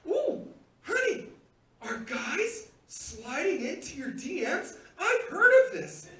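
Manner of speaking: slow, high, delighted teasing; thrilled. Retold or imitated. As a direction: draw it out in giddy, high-pitched excitement. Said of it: "I jumped up".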